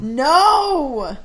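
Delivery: loud, high-pitched protest; alarmed shout. Real, not acted. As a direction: cry out to stop a friend at once. Friend threatens to call him.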